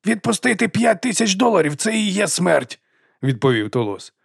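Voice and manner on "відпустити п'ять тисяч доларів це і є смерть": high, outraged outburst, slowing at the end; the dwarf refusing to let go of the gold, high outrage; indignant cry. A narrator's reading. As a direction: protest it as if it were life itself.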